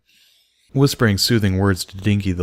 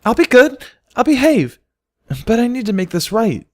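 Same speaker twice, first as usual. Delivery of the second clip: high, louder pleading; begging; 5 dB louder than usual. A narrator's reading. Filly begging Bucky to take her along. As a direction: beg him to let her go. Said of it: "Dinky begged".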